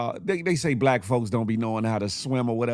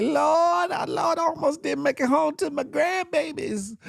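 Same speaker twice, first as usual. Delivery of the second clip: put-on much higher, wailing old lady voice; mock old lady; 13 semitones higher than usual. Host imitating the rescued woman crying to the Lord, much higher. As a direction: cry out to the Lord like a shaken grandma. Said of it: "She's like".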